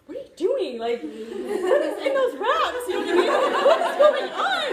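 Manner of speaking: high, loud, laughing mimicry of a flabbergasted husband; incredulous outburst. Wife mimicking husband's shock at her earnings, high incredulous yelling. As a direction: imitate a stunned husband, voice shooting up.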